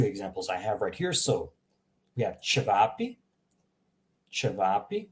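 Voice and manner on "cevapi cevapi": "cevapi" with a light soft ch at the start; careful "cevapi", opening on soft ch. Shown as a demonstration. Teacher presenting an example word, soft ch in "cevapi". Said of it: "start with the soft ch".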